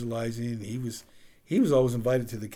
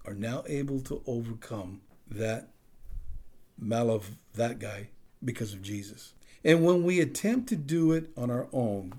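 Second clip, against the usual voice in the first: ordinary delivery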